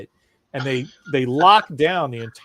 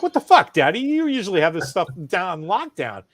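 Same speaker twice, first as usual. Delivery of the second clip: annoyed little son; 5 semitones higher, 5 dB louder than usual. Retold or imitated. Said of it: "even he's getting, like, pissed".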